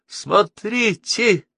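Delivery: high, drawn-out cry of alarm; horrified cry. A narrator's reading. Girl spotting the enemy from the plane, horrified cry. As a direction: cry out slowly in horror, voice high. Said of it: "вдруг в ужасе закричала".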